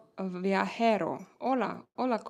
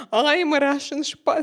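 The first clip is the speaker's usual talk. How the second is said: mock Russian spy; 6 semitones higher, 9 dB louder than usual. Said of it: "me playing in Hollywood movie".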